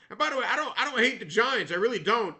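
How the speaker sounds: insistent protest. Real, not acted. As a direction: protest that you don't hate them, hard. Defensive protest to fans.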